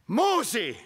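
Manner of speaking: insist, now it's serious; God calling again, stronger. Acted. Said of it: "Und so sagt Gott hier".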